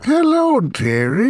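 put-on high, cackling old witch greeting. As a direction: sing it out like a Macbeth witch. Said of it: "that witch voice".

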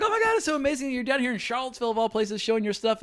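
high, breathless-fan rush of praise; retold fan gushing; starstruck gushing. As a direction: gush at your idol, high-pitched. Retelling gushing at an idol.

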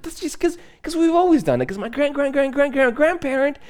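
high, flustered mock defense; defensive explaining. Explaining a family tradition to a puzzled friend, high flustered mock defense. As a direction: pitch up and fumble the excuse.

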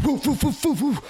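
high, sharp rhythmic breaths as wave after wave hits; imitated gasps. Surfer mimics gasping under the next wave, high and sharp. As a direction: pant through the pounding of the whitewater.

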